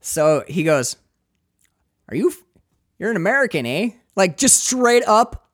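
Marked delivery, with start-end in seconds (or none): 2.1-3.9 put-on high-pitched full-on Canadian accent. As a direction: play a squeaky-high Canadian cop. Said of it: "high-pitched voice".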